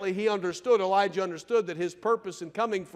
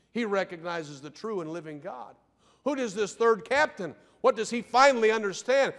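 usual speaking voice

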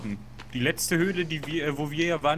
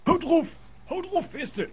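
high excited cheer; 13 semitones higher, slower than usual. Pretend. Feuert beim Bäumefällen an. (aufgeregt anfeuern)

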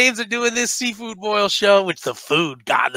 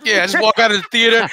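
ordinary talk, nothing marked

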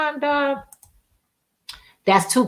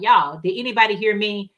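ordinary talk, nothing marked

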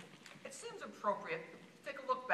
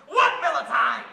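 loud, raised incredulous shout; angry retort; 5 semitones higher, 13 dB louder than usual. Acted. Voter rejecting the beer slogan, loud angry disbelief. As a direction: throw the slogan back at him.

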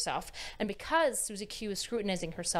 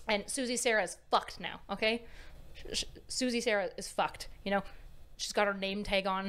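normal talking voice

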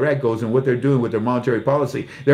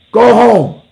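much higher and louder, shouted; bigger mock shout; 9 semitones higher, 11 dB louder than usual. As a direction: yell it the second time. Repeated louder.

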